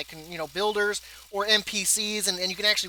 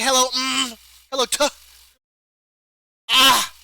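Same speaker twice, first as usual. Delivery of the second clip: mock NPC fumbling; 5 semitones higher, 7 dB louder than usual. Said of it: "Be like".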